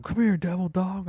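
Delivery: mock sergeant call. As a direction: summon him like a sergeant. NCO calling across parking lot.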